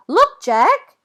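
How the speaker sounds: high, loud call across the fence; shouting to a friend. A narrator's reading. Billy shouts to Jack through the fence, loud. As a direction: call out to get his attention. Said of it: "she shouts".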